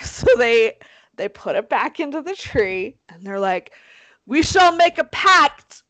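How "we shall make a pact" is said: loud, slow, high proclamation; mock-solemn oath. Kids swearing secrecy about the skull, mock-grand oath. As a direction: proclaim it grandly, stretching the words.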